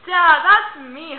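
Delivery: slow, loud comeback to dad; deadpan retort. Retold or imitated. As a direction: drag it out, offended. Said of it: "And I'm like".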